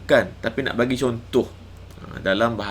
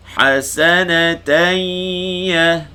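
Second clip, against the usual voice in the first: higher, louder Quranic chant, shin drawn out; Quran recitation; 6 semitones higher, 7 dB louder, much slower than usual. Shown as a demonstration. Teacher reciting a verse for students to follow, Quran chant. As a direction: recite yashfa' stretching the shin. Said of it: "Idgham ma'al gunnah lagi".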